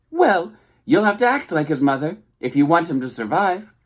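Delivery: high, loud scolding squawk; scolding goose. A narrator's reading. Goose telling the robot to make things right, high squawk. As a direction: lecture like a bossy old goose.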